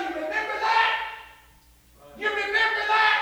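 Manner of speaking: high, shouted taunting question; accusing devil. Acted. Devil reminding a believer of past wrongs, high accusing shout. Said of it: "Oh, devil say".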